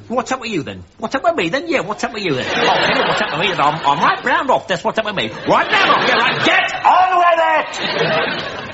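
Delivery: mock a Cockney double act, getting shriller to a shout; badly faked Cockney patter; unconvincing Cockney accents. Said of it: "incredibly unconvincing Cockney accents".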